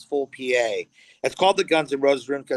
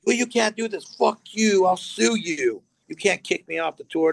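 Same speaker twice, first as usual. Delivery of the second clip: mock yelling; 5 semitones higher than usual. Crew yelling back at Glenn.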